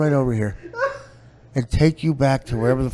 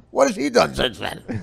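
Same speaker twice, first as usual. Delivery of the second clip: ordinary talk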